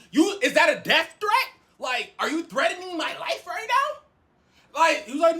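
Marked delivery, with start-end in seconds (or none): 0.1-4.0 high, incredulous retold accusation; acted-out outraged questioning; indignant challenge. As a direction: challenge them in disbelief. Acts out challenging the roommate.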